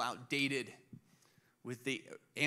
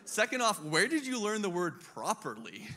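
mock incredulous; 6 semitones higher, 4 dB louder than usual. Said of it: "And I was like".

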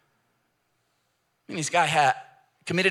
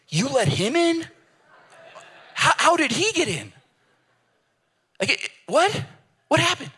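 much higher, incredulous protest; outraged disbelief; 12 semitones higher than usual. Retold or imitated. Stephen in heaven protesting Paul got in, high incredulous. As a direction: squeal it out like a saint who can't believe it. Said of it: "Can you imagine Stephen".

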